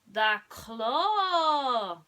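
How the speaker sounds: loud drawn-out cry of the claw; excited cry. A narrator's reading. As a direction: call out in awe at the claw. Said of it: "someone cried".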